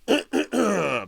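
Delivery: loud, rough throat clear. Real, not acted. Interrupting their news reading, a loud throat clear. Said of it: "Pardon me".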